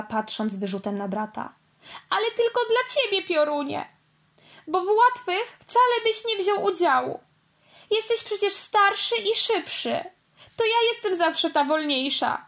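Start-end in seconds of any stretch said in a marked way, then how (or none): 2.1-12.4 high, fast tearful outburst; a little sister near tears; whimpering. Acted. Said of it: "chlipnęła". (pochlipując, wysoko)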